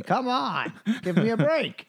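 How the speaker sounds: high, exasperated whine; whiny exasperated plea. Retold or imitated. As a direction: beg for a break, high and fed up. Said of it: "he goes".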